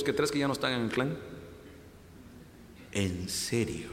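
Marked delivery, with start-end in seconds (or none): 2.9-3.9 low, soft, drawn-out disbelief; incrédulo en voz baja. Acted. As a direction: ask it low, half to yourself. Bartimeo voiced, reacting to news. (incrédulo, en voz baja y grave)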